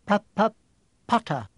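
stuttering timid teacher; nervous stammer. Acted. Quirrell greeting Harry with a nervous stammer. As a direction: trip over the first sound. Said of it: "stammered Professor Quirrell".

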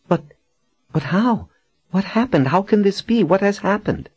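very high, stunned stammer of questions; dumbfounded. A narrator's reading. Captive learns her friend is now prelate, dumbfounded. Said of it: "Janet's jaw fell open".

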